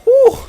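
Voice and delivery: big relieved whew; loud whew. Real, not acted. Relief after chaotic attack.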